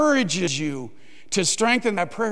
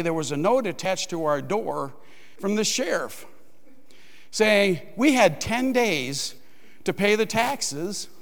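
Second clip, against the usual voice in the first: ordinary talk, nothing marked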